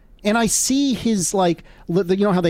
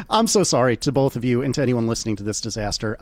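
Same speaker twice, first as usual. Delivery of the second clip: ordinary talk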